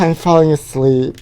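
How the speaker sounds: normal talking voice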